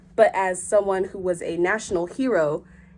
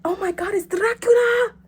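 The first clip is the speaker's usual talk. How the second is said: high, breathless mock astonishment; shocked gasp; 11 semitones higher, 3 dB louder, slower than usual. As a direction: squeal it like you just spotted Dracula. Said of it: "and you're like".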